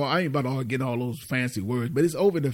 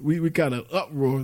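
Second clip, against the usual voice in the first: ordinary talk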